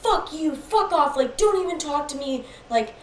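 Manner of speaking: high, agitated imitation of a rant; retold angry swearing. Sleepwalking boyfriend swearing at girlfriend, angrily agitated. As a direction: imitate his escalating, repetitive outburst. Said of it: "swearing at me".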